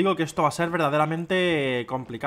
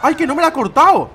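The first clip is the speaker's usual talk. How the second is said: surprised; 10 semitones higher, 9 dB louder than usual. Real, not acted. Surprised relief in game.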